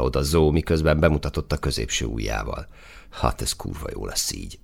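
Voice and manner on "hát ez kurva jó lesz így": low, quiet grumble; grumbling. A narrator's reading. Embarrassed soldier goes off to the corner, grumbling. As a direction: grumble it under your breath. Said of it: "dörmögte".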